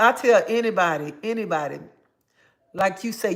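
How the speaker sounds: usual speaking voice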